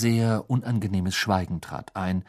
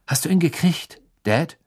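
a pointed, higher demand; sharply; 7 semitones higher than usual. A narrator's reading. Eldest son pressing his father for news, sharp. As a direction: cut straight to it, sharp. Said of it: "sagte Bill scharf".

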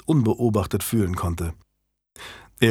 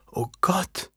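a soft, high, stunned murmur of Annabel; hushed, high little gasp of disbelief; 8 semitones higher, 7 dB quieter than usual. A narrator's reading. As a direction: breathe it out softly, overwhelmed. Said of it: "murmelte sie".

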